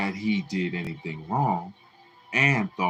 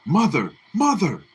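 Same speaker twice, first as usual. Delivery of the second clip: rising, louder pleas; each mother higher and more urgent; pleading alarm; 10 semitones higher, 6 dB louder than usual. A narrator's reading. Son calming his raging mother, higher each time.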